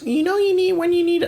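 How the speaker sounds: high sing-song imitation of a dentist; mock dentist voice. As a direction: parrot the dentist in a high squeaky voice. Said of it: "they're like".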